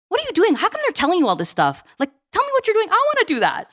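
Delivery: high-pitched imitation of an amazed husband; imitated excited, incredulous questioning; eager husband. Husband wanting in, retold.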